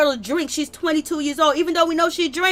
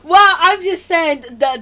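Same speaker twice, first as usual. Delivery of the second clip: ordinary talk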